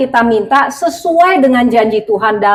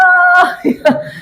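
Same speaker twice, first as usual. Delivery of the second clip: laugh; 9 semitones higher than usual. Real, not acted. Laughs at her own spiteful prayer.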